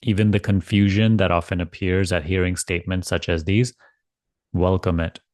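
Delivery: calm spiritual guidance, spacious and gentle; guided meditation. Acted. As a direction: ease them into letting go. Leads guided surrender meditation.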